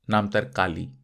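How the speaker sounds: ordinary delivery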